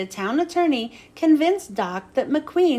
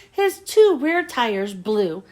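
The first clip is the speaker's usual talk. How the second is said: ordinary delivery, nothing marked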